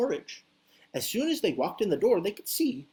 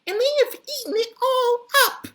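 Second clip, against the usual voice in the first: put-on squeaky Baby Bear; 7 dB louder than usual. Baby Bear sees his empty bowl, very high squeak. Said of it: "teeny tiny baby voice".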